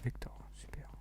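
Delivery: breathy pleased whisper. Real, not acted. Player whispers happily after looting a gun. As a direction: whisper it like a secret win.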